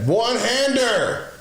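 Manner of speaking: high, slow hype over a one-handed catch; excited brag. Real, not acted. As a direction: stretch it out, thrilled with yourself. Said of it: "Look at that".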